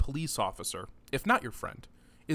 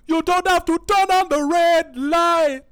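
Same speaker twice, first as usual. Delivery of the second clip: sung in a thick mock Jamaican accent, much higher, louder and slow; 14 dB louder than usual. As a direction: belt it out like a rock singer faking a Jamaican accent. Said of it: "thick Jamaican accent in Roxanne".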